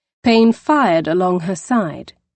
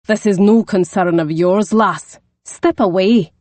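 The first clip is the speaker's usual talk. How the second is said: a hint of a gruff Scottish brogue; lightly Scottish, warning her off; 3 dB louder than usual. The Scot telling Poppy to step away, lightly Scottish. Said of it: "deep Scottish brogue".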